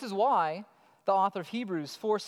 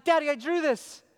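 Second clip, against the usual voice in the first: put-on higher, louder kid voice; imitated little girl; 7 semitones higher, 5 dB louder than usual. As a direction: play a child showing off to Dad. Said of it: "Or she'll be like".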